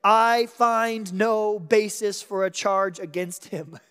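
slow, loud public declaration; bold proclamation. Retold or imitated. Pilate boldly proclaiming to the crowd, slow and loud. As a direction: announce it boldly to the crowd, slowly. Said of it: "proclaim boldly what?".

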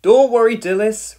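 high, very loud calling voice; loud reassurance. A narrator's reading. Fireman to panicking mother, loud reassurance. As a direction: call out firmly to reassure.

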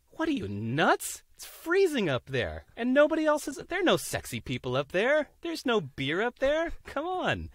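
high, loud disbelieving crowd; mock incredulous. Boat people scoff at climber.

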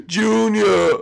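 hammed-up sobbing call; tearful wail. Dad greets Junior in tears, mock sobbing. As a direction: call the name, then break into mock bawling.

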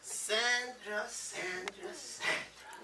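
soft, lingering repetition of a name; softly swooning over Sandra; dreamy calling. Acted. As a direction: savor her name quietly. Husband daydreams about wife.

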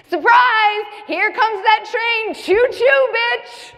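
very high, loud sing-out; mock gleeful announcement. Panic attack announcing its arrival, mock gleeful and high. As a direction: whoop it like an oncoming train.

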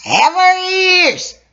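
high, slow strained voice; high character voice. Acted. Elder speaking to the gathered listeners, high and slow. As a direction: stretch the words high and slow.

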